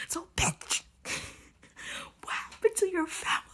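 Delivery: incredulous hushed outburst; breathy, scandalized demand; outraged whisper. Real, not acted. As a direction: whisper it in sheer disbelief. Outraged reaction to the book.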